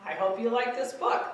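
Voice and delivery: ordinary delivery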